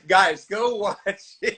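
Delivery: a real laugh breaking into the words. Real, not acted. Host laughing as he tells listeners to watch it.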